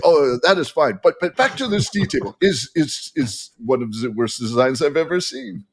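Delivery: light put-on French accent, complaining; mock French accent, fussing over the tea table. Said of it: "See, I can't, I can't help myself".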